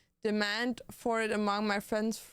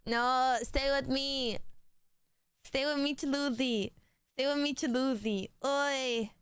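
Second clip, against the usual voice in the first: pet talk; 3 semitones higher than usual. Real, not acted. Cooing nicknames at pet.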